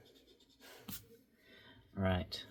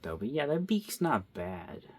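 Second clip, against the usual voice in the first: normal talking voice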